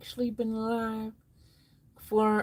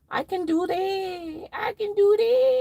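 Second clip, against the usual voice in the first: higher and higher, ending in a shrieked cry; mock excited shriek; building squeal; 11 semitones higher, 4 dB louder than usual. Escalating excited reply.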